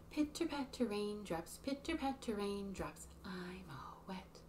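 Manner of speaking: whispered raindrops verse. Shown as a demonstration. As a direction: hush down to a whisper.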